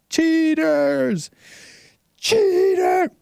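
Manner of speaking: very high screamed "Cheater!"; high mock stadium yell; mock fan yell. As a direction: scream like a furious fan in the stands. Mocking angry fans.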